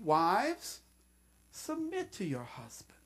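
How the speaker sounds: a soft gentle request; tender plea; gentle asking. Shown as a demonstration. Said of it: "Paul was, was asking".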